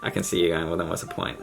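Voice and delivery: ordinary talk, nothing marked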